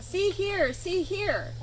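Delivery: high, hissing goose voice. A narrator's reading. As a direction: hiss it like a gossiping goose. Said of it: "hissed Necky the goose".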